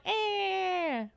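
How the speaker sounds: high, long "ehh"; imitated zombie wail. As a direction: draw out a put-on creature whine. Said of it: "And then it's like".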